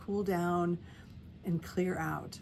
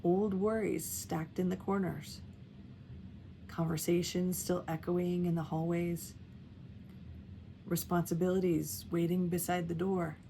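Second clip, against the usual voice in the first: guided meditation; 5 dB quieter than usual. Real, not acted. Guiding caregivers to settle into their breath, calm meditation voice. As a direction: lead gently, letting each phrase settle before the next.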